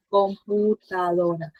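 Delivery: Computadora said slowly, syllable by syllable; slow syllable demo. Shown as a demonstration. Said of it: "tan fácil y sencillo".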